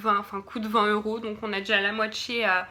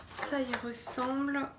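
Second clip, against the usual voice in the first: ordinary talk, nothing marked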